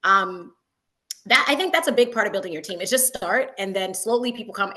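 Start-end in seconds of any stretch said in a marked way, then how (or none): none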